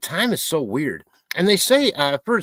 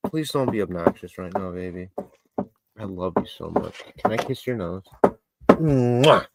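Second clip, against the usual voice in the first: tender pet talk; 7 semitones lower than usual. Real, not acted. Talking softly to his pet.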